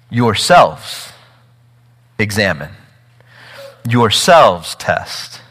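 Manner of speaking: "Yourselves" first, slow, with long pauses; slow, solemn "Yourselves test"; stress on yourselves. Shown as a demonstration. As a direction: weigh each "Yourselves" before the verb. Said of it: "that's how he says this".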